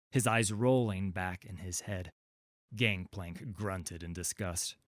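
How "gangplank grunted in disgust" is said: usual speaking voice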